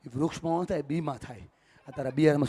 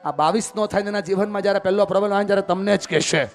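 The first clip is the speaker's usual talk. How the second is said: higher, louder urging; fervent preaching; 6 semitones higher, 8 dB louder than usual. Acted. Speaker urges parents in the crowd with rising fervour. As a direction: drive the point home to parents.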